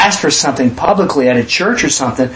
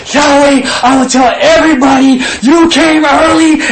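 put-on high, loud little boy praying; child's earnest prayer; 10 semitones higher, 5 dB louder than usual. Boy on his knees begs Yahweh, high and loud.

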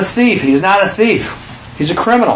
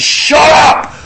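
much higher, loud mock shout; shouted rebuke; 9 semitones higher, 4 dB louder than usual. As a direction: bark it to shut him up. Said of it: "He said".

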